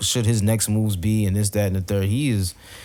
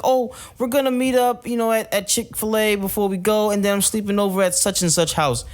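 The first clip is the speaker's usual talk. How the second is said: put-on teen daughter voice; 8 semitones higher than usual. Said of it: "she was like".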